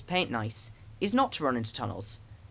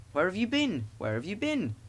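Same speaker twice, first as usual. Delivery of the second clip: mildly indignant, swooping questions; cross; 5 semitones higher than usual. A narrator's reading. Coaches scolding late Thomas, mildly cross. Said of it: "asked the coaches crossly".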